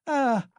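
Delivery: sudden high piercing cry of shock; wife's frantic scream at the sight of her husband; frenzied shriek. A narrator's reading. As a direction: shriek as if seeing something terrible. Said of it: "she cried out in a frenzy".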